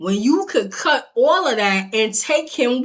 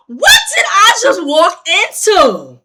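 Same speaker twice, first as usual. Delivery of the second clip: loud, high indignant disbelief; re-enacted appalled yell; shocked outburst; 6 semitones higher, 6 dB louder than usual. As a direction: shout it like you can't believe the scene. Retold shocked reaction.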